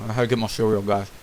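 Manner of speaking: chewing while talking; mouth full. Real, not acted. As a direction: speak round a mouthful. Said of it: "talking with my mouth full".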